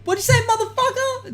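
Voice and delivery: very high-pitched attempt at menace; badly faked aggressive challenge; mock tough confrontation. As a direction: square up in a squeaky put-on voice. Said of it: "and they're all aggressive".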